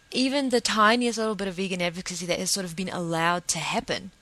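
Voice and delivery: usual speaking voice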